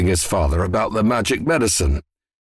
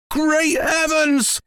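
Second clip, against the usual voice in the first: high, drawn-out exclamation of amazement; amazed outburst, pitched right up; astonished cry; much slower than usual. A narrator's reading. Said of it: "he cried".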